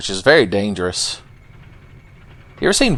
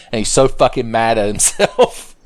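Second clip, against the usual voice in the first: normal talking voice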